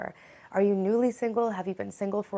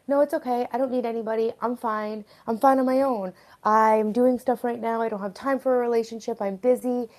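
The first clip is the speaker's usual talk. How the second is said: higher, louder brush-off to oneself; retold denial, pitched up and insistent; insistent self-reassurance; 4 semitones higher, 5 dB louder than usual. Streamer voicing what she told herself, insistent denial. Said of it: "I would always tell myself".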